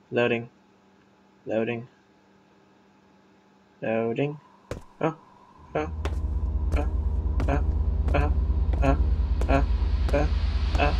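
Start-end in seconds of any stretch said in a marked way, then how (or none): 5.0-10.9 annoying oh noises. Real, not acted. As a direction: groan oh after oh to fill the silence. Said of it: "I'm making annoying noises".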